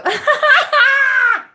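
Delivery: loud, high burst of laughter; big laugh. Real, not acted. Delighted by the troll's comment.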